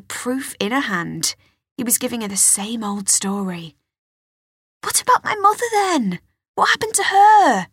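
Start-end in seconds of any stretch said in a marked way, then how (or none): 4.8-7.7 high, loud demand; upset demand. Acted. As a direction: press him hard, voice high. Girl demands about mother.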